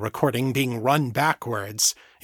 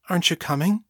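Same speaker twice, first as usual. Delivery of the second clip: put-on higher-pitched, vulnerable little girl; small, vulnerable voice; 6 semitones higher, much faster than usual. A narrator's reading. Puzzled girl asks her unmoving mother, small and vulnerable. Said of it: "her voice sounding very small and vulnerable".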